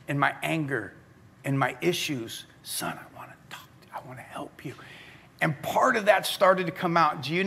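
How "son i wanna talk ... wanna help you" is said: very soft, voiceless fatherly whisper; gentle whisper. Acted. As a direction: whisper it like God reassuring a son. Voicing God speaking gently to him.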